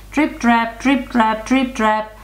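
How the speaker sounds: high, even rhythmic chant; trotting hoofbeats. A narrator's reading. Narrator sounds the second goat's hoofs, high chant. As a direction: beat out each trip and trap. Hooves sounded crossing the bridge.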